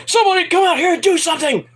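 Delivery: high, fast, urgent commentator yell; frantic commentator. Retold or imitated. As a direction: call out for help like a panicked announcer. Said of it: "As commentator, he's like".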